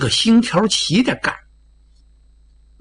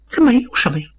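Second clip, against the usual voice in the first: plain reading voice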